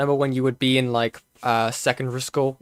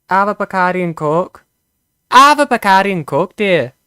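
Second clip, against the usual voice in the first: higher, louder hammed-up Scottish accent; mock Scottish accent; 6 semitones higher, 7 dB louder than usual. Streamer fumbling a Scottish accent, louder and higher. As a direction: put on a jokey Scottish accent offering a drink. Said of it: "That's Scottish".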